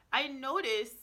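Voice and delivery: ordinary talk, nothing marked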